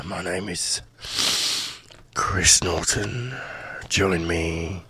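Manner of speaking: slow, low and soft, like a radio host trailing a show; Chris Norton impression. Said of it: "he could have been in the studio then".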